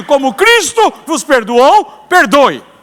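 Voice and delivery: loud, slow, emphatic reading; Christ's forgiveness announced to the church; proclaimed scripture. Shown as a demonstration. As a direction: proclaim the verse with weight. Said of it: "aqui tá escrito".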